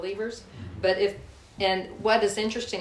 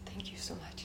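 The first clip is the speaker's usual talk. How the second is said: a soft, breathless whisper of thanks; 17 dB quieter than usual. Retold or imitated. Thanking the friend who forgave her, in a whisper. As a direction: barely voice it, moved and grateful. Grateful thanks after tearful forgiveness.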